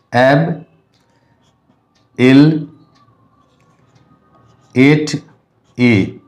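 एब, इल, एट, ए as lone syllables with long pauses; divided syllables. Shown as a demonstration. Teacher showing one way to divide the word, divided syllables. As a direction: break the word slowly into एब... इल... एट... ए. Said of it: "दो तरीके से हम divide कर सकते हैं".

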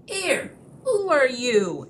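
high, squeaky alarmed purse voice; squeaking purse. Acted. The troll's purse squealing as it's lifted out, high squeak. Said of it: "it squeaked".

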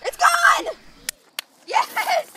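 high triumphant cheer; excited cheer. Real, not acted. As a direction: shout it like you just won. Wins the ice melting race.

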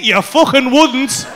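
ordinary talk, nothing marked

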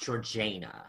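Georgiana with JAY as the stressed syllable; alternative pronunciation. Shown as a demonstration. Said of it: "I've heard it pronounced".

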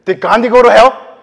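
loud, high challenge from a stranger; challenging question. Retold or imitated. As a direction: fire the question back hard. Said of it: "She, she said".

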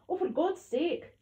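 slow, loud, high exasperation; exasperated. A narrator's reading. Gran fed up washing sick from hair, exasperated. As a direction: draw it out crossly and loudly.

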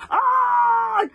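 long, very high, loud shriek; screech. A narrator's reading. Gobber shocked by the corpse, screeching. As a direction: screech at full volume in shock. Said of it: "screeched Gobber the Belch".

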